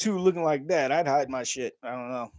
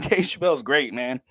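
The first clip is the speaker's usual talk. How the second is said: normal talking voice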